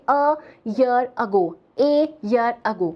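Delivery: "a year ago" spoken very slowly, word by word; slow demo. Shown as a demonstration. Teacher models "a year ago" slowly for the class. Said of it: "मैं क्या बोलूँगी".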